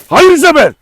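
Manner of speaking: high loud shout of no; real shout to keep the dog back. Real, not acted. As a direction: bark the name out high and loud to stop her. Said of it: "Allah'tan bağırdım ki".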